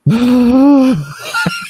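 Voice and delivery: high, rising squeal; mock freak-out squeal. Reacting to seeing the spider, high mock squeal. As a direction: let out a high mock-scared noise. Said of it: "I'm like".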